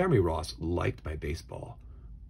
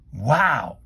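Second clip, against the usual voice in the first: amazed; 5 semitones higher, 10 dB louder than usual. A narrator's reading. Jeremy amazed at pie.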